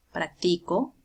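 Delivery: "practico" with the stress on TI; stress on middle syllable. Shown as a demonstration. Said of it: "por la ubicación de la tilde". ("practico" con fuerza de voz en TI)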